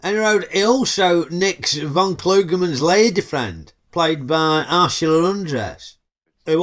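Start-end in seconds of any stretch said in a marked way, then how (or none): none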